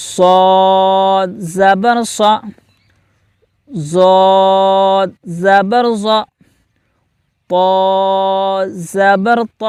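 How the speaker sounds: very slow, deliberate letter-by-letter chant; slow recitation drill. Shown as a demonstration. Teacher leading pupils in slow drill. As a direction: recite each syllable for the class to repeat. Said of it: "آپ بھی میرے ساتھ دہرائیں".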